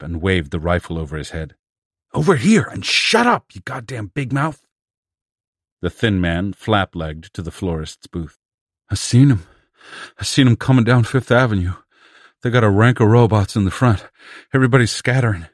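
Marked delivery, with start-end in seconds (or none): 2.1-4.7 loud, high, rough yell at the newcomer; angry yell. A narrator's reading. Plumber yells at the noisy thin man to come over. 8.9-15.5 fast, loud, high urgent news; breathless report. A narrator's reading. Thin man rushes his alarming report of the robots, loud and fast.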